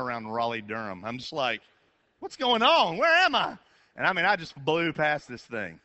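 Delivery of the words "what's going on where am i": much higher, louder lost-driver yell; mock panicked yelling. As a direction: shout it like you just realized you're lost. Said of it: "start screaming because I'm so mad".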